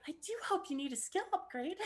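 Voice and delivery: very high, put-on female character voice; high, dainty guide voice; way-up-there girl voice. Shown as a demonstration. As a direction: play the game girl in falsetto. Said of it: "It was way up there".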